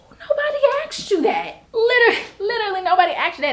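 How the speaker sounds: high, loud cry of disbelief; exasperated outburst. Real, not acted. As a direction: blurt it out, fed up and incredulous.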